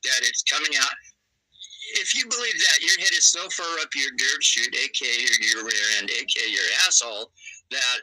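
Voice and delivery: ordinary talk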